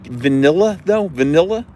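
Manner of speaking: questioning vanilla with short i, rising; imitated standard vanilla. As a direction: lift vanilla high at the end, va-NIL-la. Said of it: "She's like".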